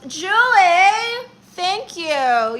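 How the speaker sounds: high loud Julie; thrilled shout-out to a viewer; excited greeting. Real, not acted. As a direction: call her name out delighted.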